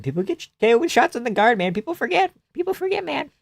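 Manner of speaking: mock squeaky voice. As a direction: squeak it like a whiny fan. Mocking someone, squeaky.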